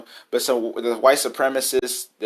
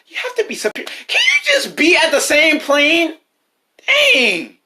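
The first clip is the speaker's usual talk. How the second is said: loud voice breaking high in disbelief; cracking angry yell; 4 dB louder than usual. Real, not acted. Peak of the angry rant.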